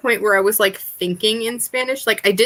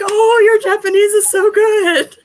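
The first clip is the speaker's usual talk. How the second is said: high, bright imitation of a Japanese compliment; mock gushing praise; 12 semitones higher, 6 dB louder than usual. Japanese listener gushing at the foreigner, high mock praise. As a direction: gush over their Japanese, much higher.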